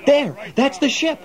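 eager, pointing out the ship; excited. Acted. Spotting the ship at the docks.

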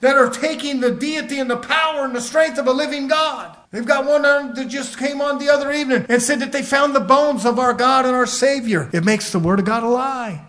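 fired-up sermon voice; full-force preaching. Real, not acted. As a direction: preach it out with indignation.

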